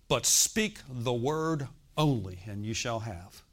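very slow, weighty pronouncement; authoritative inner voice. Retold or imitated. The Spirit speaks inside a Bible student, slow and authoritative. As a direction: imitate an authoritative voice, spacing out each word. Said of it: "this was the authoritative voice of the Spirit".

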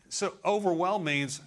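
ordinary talk, nothing marked

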